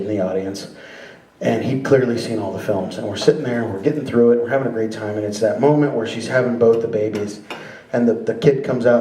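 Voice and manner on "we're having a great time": ordinary delivery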